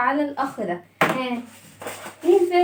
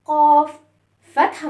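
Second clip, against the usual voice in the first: ordinary delivery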